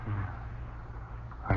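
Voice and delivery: soft breathy whisper; whispered yes. Acted. Husband whispers agreement while watching ghosts. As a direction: breathe the word out, hushed.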